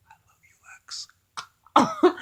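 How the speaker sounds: very slow, drawn-out whisper. Retold or imitated. Imagined old woman swooning at Lex, slow hammed-up whisper. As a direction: stretch the whisper out like a swooning sigh. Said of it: "It's like".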